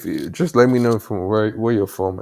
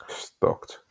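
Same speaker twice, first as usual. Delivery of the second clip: whispered; 7 dB quieter than usual. Real, not acted. Muttering to himself at screen.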